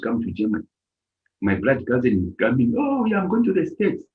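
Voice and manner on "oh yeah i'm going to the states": cousin's news in a raised pitch; imitated excited announcement. Cousin tells relative he is emigrating, mock excited announcement. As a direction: proudly announce the trip, pitched high.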